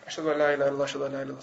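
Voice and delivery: soft, low mumbled recitation; quiet adhan. Retold or imitated. Boy told to give adhan, saying it quietly. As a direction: recite it timidly small, barely projecting. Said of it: "he would say it quietly".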